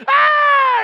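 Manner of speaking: high loud mock shriek of a caught kid; mock panicked scream. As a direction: shriek like you've just been found out.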